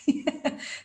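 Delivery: soft, voiceless chuckle; breathy chuckle. Real, not acted. Speaker recalling her husband telling her to hush, soft chuckle. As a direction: chuckle quietly to yourself.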